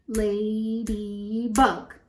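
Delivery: "Ladybug" slowly in three drawn-out beats; syllable by syllable. Shown as a demonstration. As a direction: count out each syllable of "Ladybug". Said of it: "How many syllables?".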